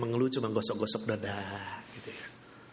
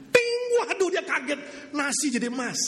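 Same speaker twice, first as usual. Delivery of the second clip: much higher startled yelp; shocked cry; 13 semitones higher than usual. Acted. Rice turning gold in her mouth, high shocked yelp. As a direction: jolt with alarm.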